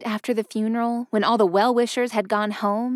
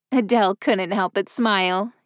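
usual speaking voice

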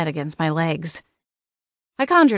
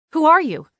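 high, loud demand; 10 semitones higher, 6 dB louder than usual. A narrator's reading. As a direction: demand an answer, voice raised. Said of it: "I demanded".

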